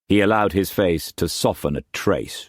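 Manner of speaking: ordinary delivery, nothing marked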